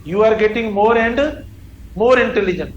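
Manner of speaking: slow, word by word dictation; slow correct example. Shown as a demonstration. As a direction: dictate the right form slowly. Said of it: "तो ये double तो आ रहा है". (सही वाक्य धीरे-धीरे बोलना)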